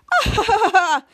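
high, loud put-on laugh; mock laugh. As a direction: burst into an imitated laugh. Imitates a newscaster's laugh.

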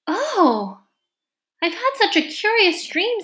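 high-pitched, excited marvelling; waking wonder. A narrator's reading. Alice wakes from her dream.

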